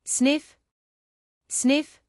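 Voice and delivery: high sniffing rabbit sound. Pretend. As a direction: sniff like a curious bunny.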